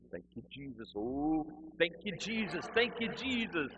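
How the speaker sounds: fervent worshipper. Retold or imitated. Worshipper up front praising before the service, with growing fervour.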